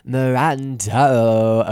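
Mirando drawn out long and loud; exaggerated name. Shown as a demonstration. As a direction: stretch Mirando into a loud, slow call. Mocks own mispronunciation, exaggerated.

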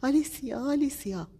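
high, loud calling out; a high, loud, frightened yell of her name; shouting her name. A narrator's reading. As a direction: call out for her in panic. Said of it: "گابریل فریاد زد".

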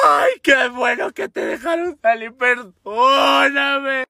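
high, slow crying shout, hammed-up; mock sobbing. Crying boyfriend greeting her release, hammed-up sob. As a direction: blubber it out like a crybaby. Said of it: "todavía está llorando este güey".

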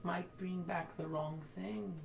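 slow eerie seer voice; a hint of a spooky oracle; creepy oracle voice. GM voicing oracle figure.

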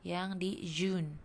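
"June" slowly with a hard j. Shown as a demonstration. Teacher contrasts j in June with y. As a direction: stretch "June" with a firm j. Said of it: "Kalau je tadi".

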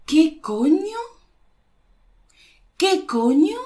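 high, rising baffled question; lost for words. Shown as a demonstration. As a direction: react speechless to a computer dying. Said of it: "Se te apaga el ordenador de repente".

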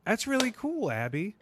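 high sweet teacher voice. Retold or imitated. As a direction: gush like a kindergarten teacher. Voicing teacher in story.

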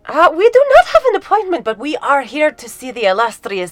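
high, loud formal request; formal adventurer voice. Acted. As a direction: speak grandly to the doorman. Character speaks to doorman.

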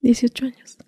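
whispering her age timidly; whispered. Retold or imitated. Frightened girl whispering her age to the cartel boss. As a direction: say it under your breath.

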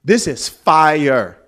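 slow, savoured repeat; slow savouring. Retold or imitated. Pastor repeating his praise of her vision, slowly. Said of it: "I was like".

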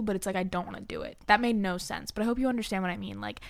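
ordinary delivery

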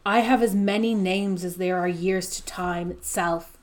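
normal talking voice

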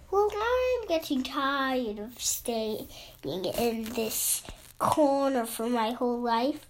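slow weary grumble to oneself; weary cow. A narrator's reading. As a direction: drag it out softly, fed up with staying put. Cow voicing his weary decision.

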